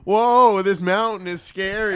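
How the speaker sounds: loud, high hammed-up alarm; mock fright. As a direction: play at being scared on a mountain. Acting out VR climbing scare.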